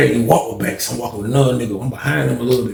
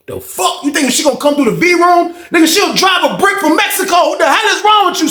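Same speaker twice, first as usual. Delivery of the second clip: mock yell. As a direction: yell it like you can't believe him. Retold thought, yelling at friend.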